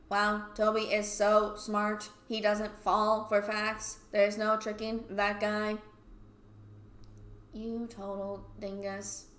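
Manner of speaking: slow, deadpan mockery; sarcastic praise. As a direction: lay on fake admiration, dragging every word. Sarcastic praise of Toby, then insult.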